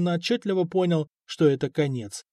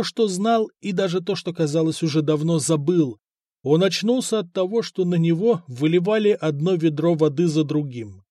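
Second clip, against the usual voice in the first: usual speaking voice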